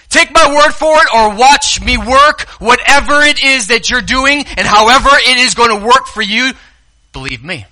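high, loud urging; Jesus begging them to trust; passionate plea. Acted. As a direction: preach it with fire. Paraphrasing Jesus emphatically.

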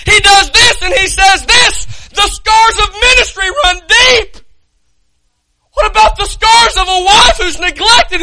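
high, loud, crying-edged pulpit shout; fiery preaching. Real, not acted. Preacher pleading with the congregation about scars, full-force shouting. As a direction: pour it out like a revival preacher.

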